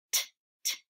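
t t whispered, then ter, ter, eat as separate sounds; sounding out treat. Shown as a demonstration. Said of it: "be careful with that ter".

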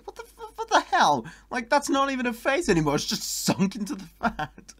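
loud, high-pitched outburst of shock; appalled disbelief. Real, not acted. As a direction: cry out in disbelief, voice jumping way up. Shocked reaction to grotesque picture.

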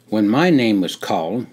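plain reading voice

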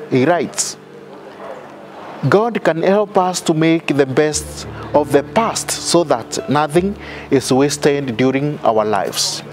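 ordinary talk, nothing marked

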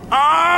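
high puppet gasp; startled dummy yelp. Acted.

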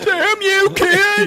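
put-on high, loud cranky old man yelling; angry shout. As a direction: shake your fist and yell at the kids. Voicing the angry prank victim.

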